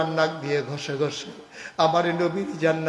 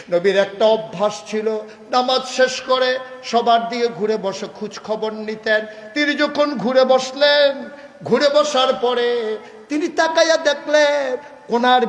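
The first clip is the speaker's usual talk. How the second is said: raised, loud sermon storytelling; impassioned preaching; 8 semitones higher, 6 dB louder than usual. Real, not acted. Preacher telling how the Prophet turned round, loud rising delivery. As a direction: build the tale with a ringing voice.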